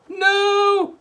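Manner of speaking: high, loud panicked cry. A narrator's reading. The family crying out to stop Grandma, high and loud. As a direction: cry out in alarm. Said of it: "cried the other two".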